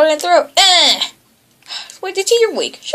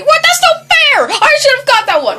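high-pitched whine of outrage; sore, raised-pitch complaint; indignant protest; 10 semitones higher, 3 dB louder than usual. Real, not acted. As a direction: protest loudly at being cheated. Protests losing an item.

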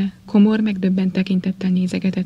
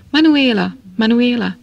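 raised, urgent narrated call, higher at first; frantic shouting; 3 semitones higher, 5 dB louder than usual. A narrator's reading. Harvey runs downstairs after the servant, calling out urgently. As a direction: cry the name out sharply, twice. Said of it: "eszeveszetten kiáltozta a szolgáló nevét".

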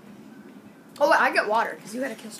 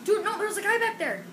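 excited fast; 10 semitones higher, 3 dB louder, faster than usual. Real, not acted. Warning teammate mid-game.

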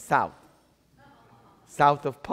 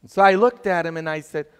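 ordinary delivery

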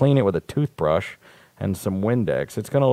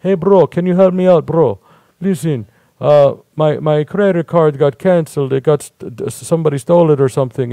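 higher, louder hustler begging a stranger for gas money; scammer plea; 5 semitones higher, 4 dB louder than usual. Retold or imitated. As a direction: sell the sob story, bro. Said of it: "they're like".

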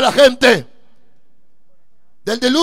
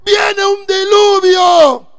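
slow, drawn-out shout, higher; a prophet crying out the warning, slow and loud; preaching cry; 6 semitones higher, 6 dB louder, much slower than usual. Retold or imitated. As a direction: call out the warning slowly. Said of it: "Noé pregonaba la palabra del Señor diciendo".

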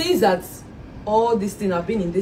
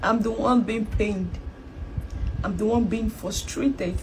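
weary hurt; 7 dB quieter, slower than usual. Acted. Wife wearily complains.

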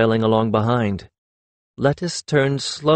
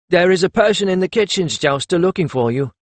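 higher-pitched servant voice; 6 semitones higher than usual. A narrator's reading. Servant telling Perry of a visitor, higher-pitched servant voice. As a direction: deliver a message as a servant. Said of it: "the servant said".

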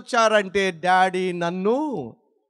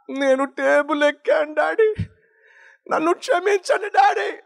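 imitating a crying child; 7 semitones higher than usual. Son crying to his father, begging forgiveness tearfully. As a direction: rise into a tearful cry for forgiveness. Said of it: "అని ఏడ్చాడు".

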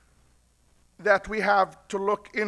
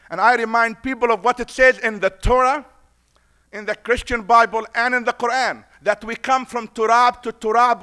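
louder preacher's build to the climax; rising preaching; 2 semitones higher, 8 dB louder than usual. Acted. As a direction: lift the congregation, voice swelling.

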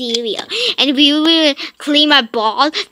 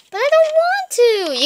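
much higher whiny protest; whiny little kid; 13 semitones higher than usual. Acted. Son refusing to tell dad the truth, whiny. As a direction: whine like a scared small child.

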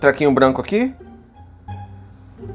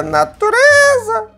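high slow announcing; slower than usual. Shown as a demonstration. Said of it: "Efeitos especiais".

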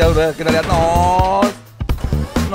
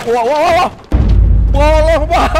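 excited; 10 semitones higher, 7 dB louder than usual. Real, not acted. Shocked exclaiming at game chaos.

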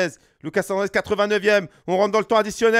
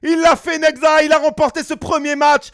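higher, louder victory call; excited call; 5 semitones higher, 5 dB louder than usual. Real, not acted. Commentator hailing the player's win, excited call. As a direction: proclaim the win with joy.

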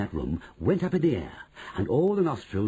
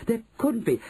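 high, disbelieving shout; 13 semitones higher, 3 dB louder than usual. Acted. Other witches doubt her, high shout. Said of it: "the others shouted".

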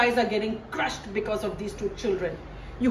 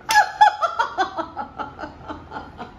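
high breathy villain's laugh; evil laugh. Acted. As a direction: cackle over the wicked plan. Stepmother's wicked plan laugh.

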